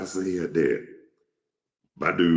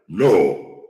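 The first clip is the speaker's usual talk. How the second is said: curt answer; 3 semitones higher, 8 dB louder than usual. Retold or imitated.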